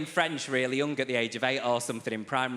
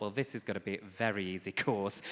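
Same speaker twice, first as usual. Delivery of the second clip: much softer and lower, to himself; smug inner thought; 6 semitones lower, 7 dB quieter than usual. Retold or imitated. Student's private smug thought at the first lecture, softly. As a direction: think it quietly, smug.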